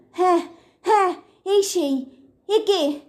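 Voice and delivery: shocked recognition. Acted. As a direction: blurt it out with a nervous laugh. Recognizing the ghost's face in the photo.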